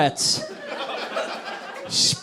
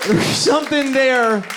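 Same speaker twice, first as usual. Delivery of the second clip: repeat; 8 semitones higher, slower than usual. Acted. Repeated point, slightly softer.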